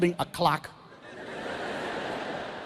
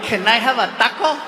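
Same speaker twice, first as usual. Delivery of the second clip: put-on like a kid. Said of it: "I feel like a kid".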